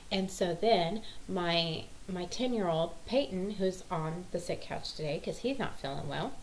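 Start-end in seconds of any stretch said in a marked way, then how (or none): none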